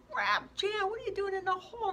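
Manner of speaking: very high, loud, fast cry of disbelief; shocked shriek. Acted. Girl shrieks at Jan for jumping into the hole. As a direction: shriek at the friend who jumped in.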